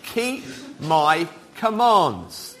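very slow, deliberate verse reading; spaced-out, carefully clear reading. Real, not acted. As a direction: read it out word by word so all can hear. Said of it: "hear the words nice and clearly".

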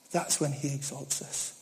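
hushed repetition to let it land; soft echo. Real, not acted.

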